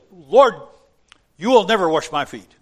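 loud, high, fast refusal; bold Peter. Retold or imitated. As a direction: Peter flatly refusing the Lord. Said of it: "I can just hear the tone of voice".

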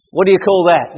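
ordinary delivery

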